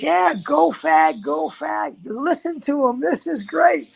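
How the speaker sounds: imitating a friend cracking up at a chant; retold laughing friend. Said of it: "And he's laughing".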